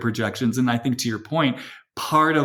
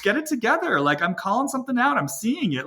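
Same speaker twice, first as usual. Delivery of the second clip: diva voice; 7 semitones higher than usual. Retold or imitated. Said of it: "the diva's the one who comes in and says".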